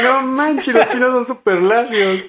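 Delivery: surprised. Retold or imitated. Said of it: "Y dije".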